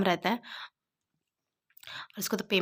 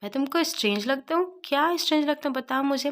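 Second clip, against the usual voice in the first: higher, indignant mock offense; angry; 6 semitones higher than usual. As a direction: demand an answer, affronted. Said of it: "युवान गुस्से में बोलता है".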